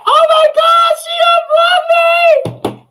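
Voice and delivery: high gasping shriek of joy, almost crying; ecstatic scream. Real, not acted. Streamer seeing she got unblocked, shrieking with joy. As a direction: scream it out, overjoyed.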